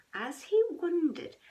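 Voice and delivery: plain reading voice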